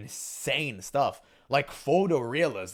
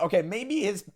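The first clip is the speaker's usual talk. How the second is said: voice crack; 7 semitones higher, 3 dB louder than usual. Real, not acted. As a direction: voice cracking up high. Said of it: "I have a voice crack".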